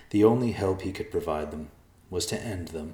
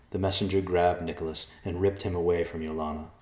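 ordinary delivery, nothing marked

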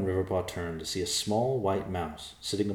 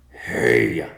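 loud, lower bark of a call; 6 semitones lower, 11 dB louder than usual. Acted. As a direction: get the bird's attention. Bear calling the ignoring vulture.

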